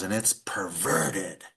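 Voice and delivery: drawn-out, slow pastor's judgement; slow preacher condemnation. Retold or imitated. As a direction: pastor from the pulpit condemns homosexuals, slow and heavy.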